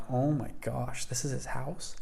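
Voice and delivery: retold awe, dropped to a soft voice; softly marvel, as if under your breath; hushed amazement. Retelling awed arrival at mansion.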